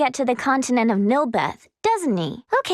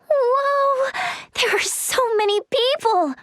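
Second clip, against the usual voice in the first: high, drawn-out wonder; amazed first-time visitor; awestruck; 8 semitones higher, slower than usual. Acted. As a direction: take in the crowds in awe. Awed by the crowded capital.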